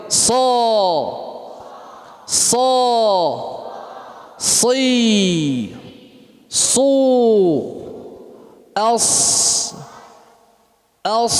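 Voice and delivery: high, drawn-out syllables "Sa," "si," "su," "as"; drilled letter sounds. Shown as a demonstration. Teacher drilling the class on sad syllables. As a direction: sound out each syllable slowly and clearly. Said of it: "teacher drilling letter sounds".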